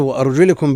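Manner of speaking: وأرجلكم with an i after the lam, "wa-arjulikum"; kasra reading. Shown as a demonstration. Teacher cites Ibn Kathir's reading, وأرجلكم with "li". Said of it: "بالخفض".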